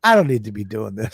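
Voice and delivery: low, quick, fed-up mumble of impatience; mock weary impatience, pitched well down; anxious to finish. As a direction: imitate someone wanting it over with. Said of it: "It's an anxious to get it over with voice".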